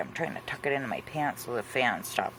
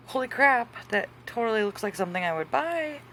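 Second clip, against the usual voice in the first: retold amazement; 8 semitones higher than usual. Retelling her amazement at the picture.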